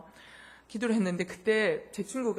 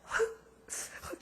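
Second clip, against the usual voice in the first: genteel little sniffled sob, imitated; dainty mock sob; 5 dB quieter, much slower than usual. Shown as a demonstration. Showing listeners how one cannot cry politely, dainty mock sob. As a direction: demonstrate crying in a refined way. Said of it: "그냥 고상하게 이렇게".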